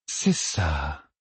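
soft, absent murmur; talking to herself. A narrator's reading. Villainess watches her captive work, murmuring to herself. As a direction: say it softly, half to yourself. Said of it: "comme si elle parlait toute seule".

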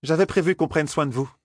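high-pitched character voice, falling statement; matter-of-fact Aes Sedai. Acted. Aes Sedai telling intruders of her plan, high matter-of-fact statement. Statement later echoed as question.

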